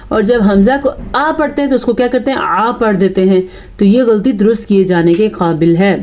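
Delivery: ordinary talk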